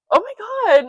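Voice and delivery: high, loud startled exclamation; retold shock at the picture; shocked gasp. Gasp at scary first picture.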